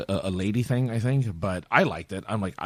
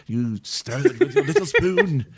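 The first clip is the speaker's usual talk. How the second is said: mock-proper British tea drinker; posh British accent; 3 semitones higher than usual. Acting out afternoon tea, mock posh British accent. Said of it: "don't talk to the staff that way".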